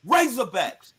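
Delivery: team cheer. Acted. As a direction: end the hog call by yelling the team name. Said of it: "you know what I'm gonna do".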